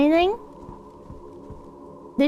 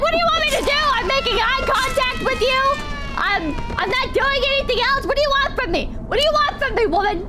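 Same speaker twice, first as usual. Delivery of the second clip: higher, pleading what-do-you-want; exasperated retort; 8 semitones higher than usual. Retold or imitated. Retelling a childhood scolding, exasperated and pleading. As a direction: throw up your hands at mom. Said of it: "And it's like".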